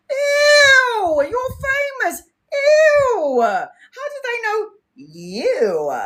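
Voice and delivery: very high, whiny teasing voice; mocking siblings. Siblings teasing her about fame, high whiny squeal. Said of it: "my siblings were like".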